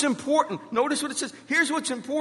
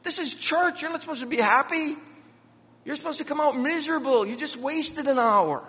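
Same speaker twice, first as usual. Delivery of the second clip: higher, bubbly retelling of a mock scolding; lightly voiced bubbly wife, pitched up; happy and bubbly; 6 semitones higher than usual. Wife back from church, told in a higher bubbly voice. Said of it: "she'd come in all happy and all bubbly and say".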